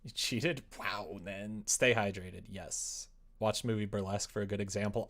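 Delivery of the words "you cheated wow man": ordinary talk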